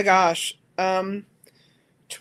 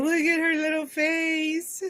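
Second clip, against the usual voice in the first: overwhelmed by cuteness; 8 semitones higher, slower than usual. Real, not acted. Cooing over a cute doll.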